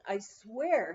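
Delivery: usual speaking voice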